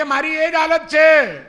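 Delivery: high, loud, slow reply across distance; shouting back. Retold or imitated. Companion monk calling back across the jungle, slow loud shout. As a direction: yell it back, drawn out.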